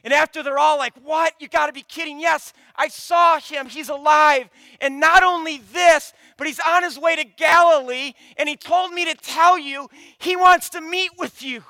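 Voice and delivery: bursting with the news; excited. Pretend. Mary Magdalene to the disciples, excited and loud. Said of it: "and she says".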